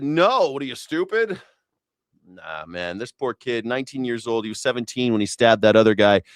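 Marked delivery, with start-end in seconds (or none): 0.0-1.4 higher, mocking; a higher dismissive snap; scornful retort. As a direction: scoff at the question. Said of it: "I said".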